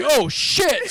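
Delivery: high, loud oh shit; a cop stunned by the confession, in a high loud blurt; shocked exclamation. Retold or imitated. Cop's shocked reaction.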